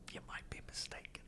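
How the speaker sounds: whispered aside; hushed, under the breath. Real, not acted. Aside apology to Chet.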